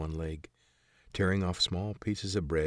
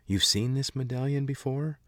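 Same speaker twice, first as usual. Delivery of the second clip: surprised; 7 semitones higher, 4 dB louder than usual. Acted. Kate surprised by stranger.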